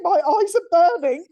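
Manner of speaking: high, loud cry of mock agony; mock shout. A narrator's reading. Reader acting out the boyfriend's scream, high mock shout. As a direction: yell it like a melodramatic victim.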